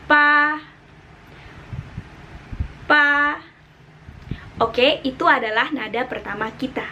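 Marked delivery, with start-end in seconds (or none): 0.1-3.4 pa held level and high; first tone. Shown as a demonstration. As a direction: keep pa flat and high. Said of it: "Kita coba sama yang lain". (nada pertama, pa datar tinggi)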